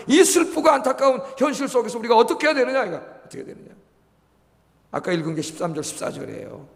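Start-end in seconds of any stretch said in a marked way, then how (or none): 2.8-3.7 a hushed, breathy repeat of the question; whispered echo. Acted. Pastor echoing his own question to the congregation in a whisper. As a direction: whisper the question back to yourself.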